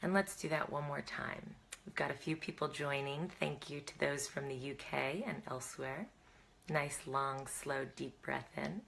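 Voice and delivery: soft, unhurried breathing-exercise voice; guided breathing. Real, not acted. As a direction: lead them gently through each breath, quietly.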